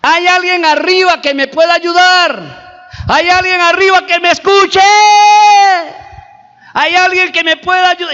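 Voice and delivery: high, loud call up into the night; stranded climber yelling upward; shouting for help. Retold or imitated. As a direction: cry out to anyone above. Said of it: "y gritaba".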